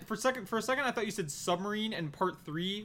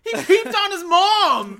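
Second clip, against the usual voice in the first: high, loud, drawn-out accusation; outraged yell; 11 semitones higher, 12 dB louder, slower than usual. Real, not acted. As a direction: shout it slowly in comic outrage. Outraged accusation in joking argument.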